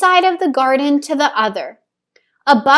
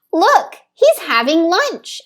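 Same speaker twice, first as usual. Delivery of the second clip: put-on higher, delighted little girl pointing; excited child; 7 semitones higher, 4 dB louder than usual. Theo points out the robin's lunch to Papa, higher delighted voice. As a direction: show Papa with glee. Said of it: "added Theo".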